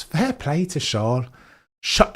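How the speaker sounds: usual speaking voice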